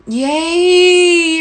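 high, loud happy squeal; excited cheer. Real, not acted. Singer cheering a fan on live, squealing. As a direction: burst out cheering.

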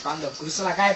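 normal talking voice

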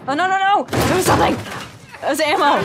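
loud, high cry of dismay; frantic dismay. Real, not acted. Gamer leaving ammo behind, frantic shout. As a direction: wail loudly at what you missed. Loses ammo during a chase.

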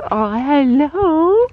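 high, slow, rising hello; sing-song pet greeting; cooing hello. Real, not acted. Greeting horse.

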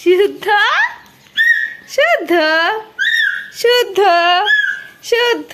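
high, loud repeated call. Real, not acted. Calling child repeatedly.

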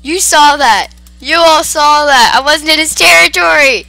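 loud, high-pitched wailing protest; frantic outcry; distressed yelling. Real, not acted. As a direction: yell it in panic, pleading innocence. Panicked protest after game attack.

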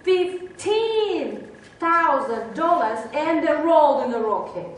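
loud, slow drawn-out announcement; amazed reveal. Real, not acted. Storyteller reveals the dog's price to audience, emphatic. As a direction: stretch out the punchline with big emphasis.

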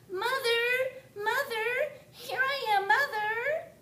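very high, loud calling; a chick crying out for mother; eager baby bird. Acted. Baby bird runs to the big thing, high and eager. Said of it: "he said to the big thing".

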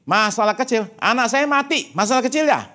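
high, indignant, bitter retort; mock outrage of a grieving parent; angry letter writer. Grieving parent writing to the author, indignant and high. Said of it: "Yang tulis surat ke dia, bilang gini".

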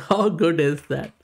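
laughing, delighted rhetorical question; amazed laugh. Real, not acted. Same question repeated, amazed.

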